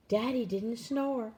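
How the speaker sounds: plain reading voice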